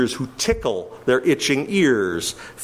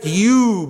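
"you" punched out, louder; emphatic you; 4 semitones higher, 4 dB louder than usual. Shown as a demonstration. Preacher quoting Paul, an emphatic "you". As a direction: hit "you" hard. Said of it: "an emphatic".

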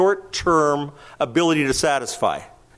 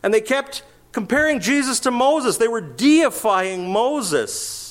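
rising preaching build; 10 semitones higher than usual. Acted.

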